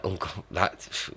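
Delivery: usual speaking voice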